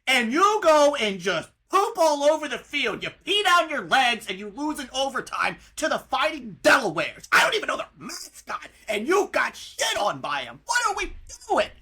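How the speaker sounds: loud, exasperated rant at the team; real heated outburst. Real, not acted. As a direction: yell at them like a betrayed fan. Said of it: "I'm getting really heated this episode".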